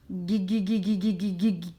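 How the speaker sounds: GUI repeated in a quick even chain to isolate the G; repeated syllable sound. Shown as a demonstration. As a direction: bounce on GUI, over and over, before completing the word. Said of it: "à chaque syllabe".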